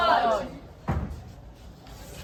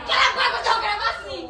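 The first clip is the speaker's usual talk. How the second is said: laughing; 9 semitones higher, 5 dB louder, faster than usual. Real, not acted. Friends joking during recording.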